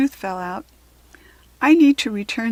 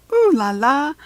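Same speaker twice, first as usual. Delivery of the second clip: fancy; 4 semitones higher, 4 dB louder than usual. A narrator's reading. Showing off necklace.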